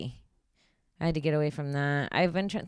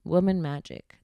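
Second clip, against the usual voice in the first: usual speaking voice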